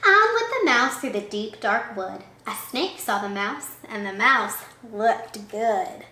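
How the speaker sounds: usual speaking voice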